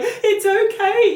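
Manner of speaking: very high, slow cooing; mother cooing it's okay to her youngest; soothing mum. Retold or imitated. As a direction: stretch it out to calm a child. Mum comforting the baby.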